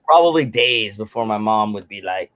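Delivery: ordinary delivery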